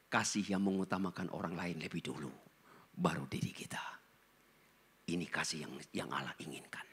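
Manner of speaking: low, hushed whisper; whispered point. Real, not acted. Preacher draws the congregation close in a whisper. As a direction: drop to a whisper to draw them in.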